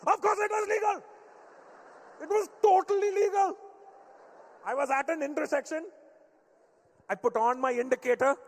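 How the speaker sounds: high loud outraged Indian-dad protest. Retold or imitated. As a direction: insist it was totally legal, outraged. Dad voiced, defending legality.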